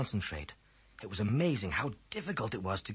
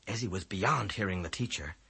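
ordinary delivery, nothing marked

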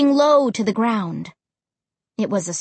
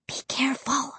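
soft, breathy whisper; whispered warning; 3 semitones higher, 8 dB quieter than usual. A narrator's reading. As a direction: whisper a caution to your brother. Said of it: "I whispered".

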